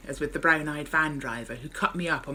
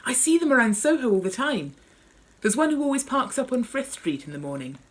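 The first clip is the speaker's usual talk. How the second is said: eager chatter; 8 semitones higher, 4 dB louder than usual. Acted. Eager narrator continues excitedly.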